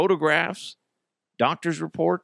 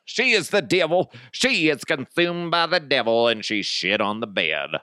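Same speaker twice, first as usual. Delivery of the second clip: preacher voice. Acted. Mock preacher condemning the wife as devilish, preacher voice. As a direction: denounce her like a hammed-up preacher. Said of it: "I went into the preacher voice".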